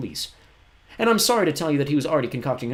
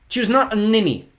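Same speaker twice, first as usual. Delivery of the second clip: put-on much higher, indignant boy's cry; cried out; 12 semitones higher, 8 dB louder than usual. A narrator's reading. Klaus defends Josephine against Olaf, crying out. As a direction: Klaus protesting, voice pitched high. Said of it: "Klaus cried".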